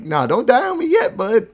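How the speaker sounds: hammed-up gangster threat; loud high parody of a gloating boss; mock menace. Retelling Marcellus threatening Zed.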